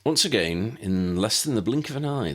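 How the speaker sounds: usual speaking voice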